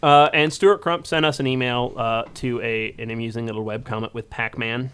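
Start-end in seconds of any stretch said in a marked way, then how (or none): none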